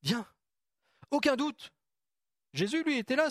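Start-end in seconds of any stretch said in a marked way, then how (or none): 0.0-1.7 encouraging call. Retold or imitated. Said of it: "il lui dit".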